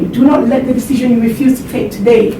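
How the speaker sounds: usual speaking voice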